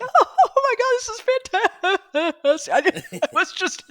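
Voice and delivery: half-laughing cry of joy at the finish. Retold or imitated. Rider recalling the last day, laughing through the words. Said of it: "Laughing and crying".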